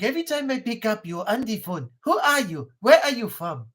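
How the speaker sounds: sing-song put-on accent, pitch swooping; quizzing interrogator with exaggerated swooping accent; put-on accent phone prank. Pestering a spam caller after picking up, put-on accent. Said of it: "I like picking it up and going".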